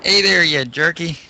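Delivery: hammed-up Italian greeting; lightly faked Italian accent; bad Italian accent. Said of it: "Us doing Italian accents".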